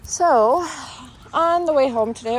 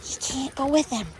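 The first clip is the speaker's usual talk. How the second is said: talking to dog; 4 semitones higher, 6 dB quieter than usual. Real, not acted.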